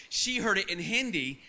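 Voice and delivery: usual speaking voice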